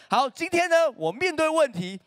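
broadcast it to the whole hall; loud public announcement. Retold or imitated. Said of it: "那种大声公开说".

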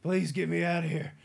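mock pleading. Seagal begging the massaging doctor, loud mock plea. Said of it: "And Seagal's like".

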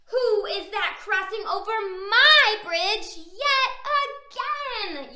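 exasperated story-time troll yell, pushed up; troll's yell. A narrator's reading. Troll challenging Big Billy yet again, yelling. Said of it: "yelled Mister Troll".

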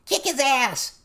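high-pitched goblin yell; loud, squealing heckle; shouting. A narrator's reading. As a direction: shout it high, egging on a fight. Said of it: "Cherry Pop shouted from a dark corner".

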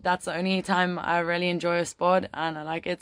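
normal talking voice

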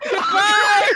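loud laughing goodbye; laughing shout. Real, not acted. Dying in game, laughing.

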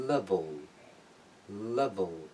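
"level" said carefully, clear L first, dark L last; careful "level" with swallowed final L; repeat-after-me model. Shown as a demonstration. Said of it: "پس از من تکرار کنید".

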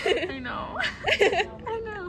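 laughing. Real, not acted. Giggling fit continues.

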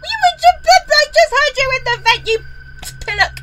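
loud, indignant telling-off; angry scold. Real, not acted. Gamer scolding animatronic in the vent, loud indignant. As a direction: berate the robot loudly.

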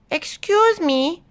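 high, slow, polite interruption; small voice piping up from the dark; slow, high-pitched "excuse me"; mysterious cave voice. Acted.

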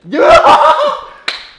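loud, high shrieking laugh; high burst of laughter. Real, not acted.

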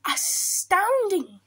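high, slow, stretched exclamation; astounded Gruffalo. Acted. Gruffalo sees owl flee the mouse, astounded. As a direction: draw it out in awe.

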